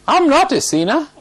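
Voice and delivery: high, rising mock protest; indignant denial. Offended fellow denying sin to the preacher's face, indignant. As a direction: imitate an offended man, pitched right up. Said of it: "Straight to my face".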